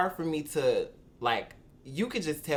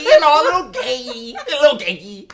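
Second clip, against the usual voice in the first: raised, louder, laughing voice, much higher; 6 semitones higher, 10 dB louder than usual. Shown as a demonstration. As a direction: push it up high and giggly. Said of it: "my voice is kinda high".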